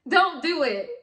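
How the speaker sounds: high and loud, a firm warning to viewers; stern warning shout, don't pressed hard. Real, not acted. Warning viewers against Bitcoin scams.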